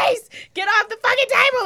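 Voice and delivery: yell. Retold or imitated. Mom yelling at son on table.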